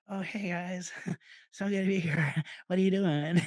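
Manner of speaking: high, nervous, laughing greeting; shrinking nervous speaker. Retold or imitated. Speaker shrinking before the crowd, nervous and laughing. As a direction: laugh it off like you don't belong. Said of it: "if I shrank in that moment".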